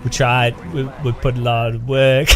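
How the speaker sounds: put-on a modest Finnish promo voice in a hesitant Finnish accent; attempted Finnish accent. Imitating a humble Finnish company video in a shy Finnish accent. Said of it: "I can't do Finnish".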